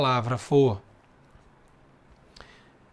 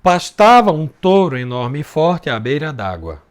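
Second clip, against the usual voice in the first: louder measured recitation; reading the verse; 3 semitones lower, 5 dB louder than usual. Shown as a demonstration. Teacher rereading the first verse aloud, measured. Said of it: "Vamos fazer o segundo verso".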